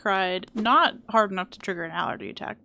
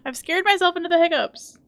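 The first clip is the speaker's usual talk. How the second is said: high, loud startled yelp; 9 semitones higher, 8 dB louder than usual. Real, not acted. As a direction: blurt it out startled. Startled by game.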